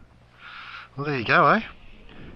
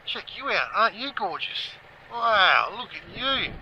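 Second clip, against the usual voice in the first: talking to animals; 8 semitones higher than usual. Acted. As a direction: coo at a sweet creature like a pet. Cooing to farm animals.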